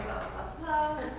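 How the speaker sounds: quietly skimmed-over sobbing lines; murmured blah-blah. Acted. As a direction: murmur the blahs under the breath.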